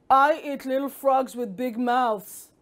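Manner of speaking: lightly voiced storybook snake; a hint of a snake's threat; menacing snake. Snake warning the big-mouthed frog, lightly menacing. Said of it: "looked at the frog and said".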